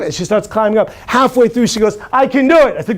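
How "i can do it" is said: excited. Retold or imitated. Child halfway up the ladder.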